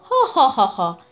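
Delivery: put-on hearty ha-ha-ha of a proud old warrior; booming boastful laugh. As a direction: let out a big proud belly laugh. Krato laughs at his own boast.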